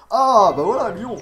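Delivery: loud, high burst of triumph; ah bah voilà, yelled high with joy; excited outburst. Real, not acted. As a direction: shout it out as the roll lands. Gets the city he wanted.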